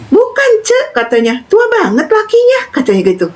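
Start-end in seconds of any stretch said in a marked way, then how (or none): 0.1-1.0 high-pitched mock busybody granny; imitated nosy grandma. Retelling a nosy grandmother's remark. 1.5-2.6 high-pitched mock busybody granny; imitated nosy grandma. Retelling a nosy grandmother's remark.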